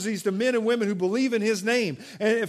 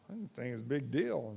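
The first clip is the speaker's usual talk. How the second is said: softer shrug; 6 semitones lower, 10 dB quieter than usual. Retold or imitated. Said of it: "I was like".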